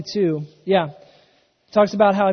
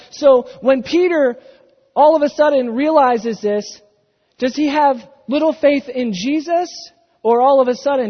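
rising, louder preacher's build; impassioned preaching; 4 semitones higher, 6 dB louder than usual. Acted. Pastor preaching to the congregation, building fervor.

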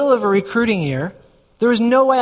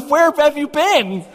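slow, higher-pitched mock astonishment; imitated stunned wife; 7 semitones higher, slower than usual. Wife to husband after the job offer, mock stunned. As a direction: stretch it out high, imitating his wife. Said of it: "She's like".

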